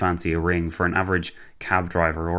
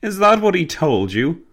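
loud, high-pitched booming question; 10 semitones higher, 5 dB louder than usual. A narrator's reading. Gentleman hearing his friend's tall tale, booming. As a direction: boom it out incredulously. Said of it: "Alistair boomed".

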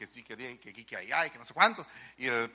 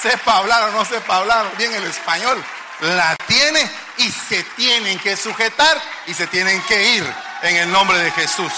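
loud, raised declaration; preaching at full force; 5 semitones higher, 12 dB louder than usual. Real, not acted. Preacher declares authority in Jesus' name, full-force shout. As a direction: thunder it out to the congregation.

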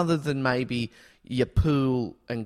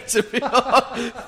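laughing; 10 semitones higher, 4 dB louder, slower than usual. Real, not acted. Laughing at the impression.

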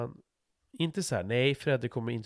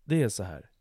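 usual speaking voice

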